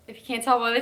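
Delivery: out of breath. Real, not acted. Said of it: "I am so out of breath".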